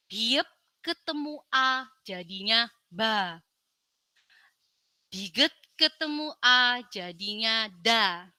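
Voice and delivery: slowly joining letters into syllables; slow blending. Shown as a demonstration. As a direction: sound out each step slowly. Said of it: "Dibaca bersama-sama".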